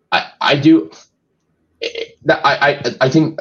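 halting false starts with pauses between; stuttering. Real, not acted. Co-host struggling to pick a draft round, stuttering. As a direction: stumble over the first word, searching for the thought. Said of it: "I'm stuttering".

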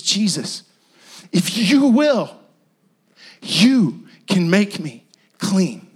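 slow, sure declaration of faith; confident plea. Retold or imitated. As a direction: say it knowing he can. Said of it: "he says".

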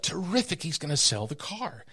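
excited. Retold or imitated. Retold excited thought.

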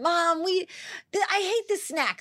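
put-on high whiny child complaining; whiny kid voice. Hungry crying kids quoted.

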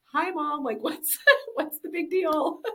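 hint of a bored little boy, slowly; child's shrug. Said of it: "he was just like".

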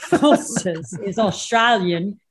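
slow, laughing Australian slogan; imitated Aussie accent. Shown as a demonstration. Guest copies host's beer joke, slow imitated Aussie accent. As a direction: draw out the Aussie tagline, amused. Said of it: "that way you said Australian, excellent".

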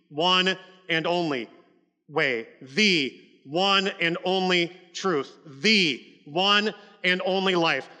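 "one and only" spoken very slowly and weightily; stress on one and only. Shown as a demonstration. Preacher insisting to the congregation on the one and only way, slow and weighty. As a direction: stretch out "one and only" on each phrase, pressing every word. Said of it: "give it emphasis".

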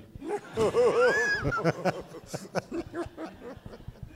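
long helpless laugh at his own joke. Real, not acted. Pastor laughing at his own church joke, long laugh. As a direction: let the laugh roll out and fade.

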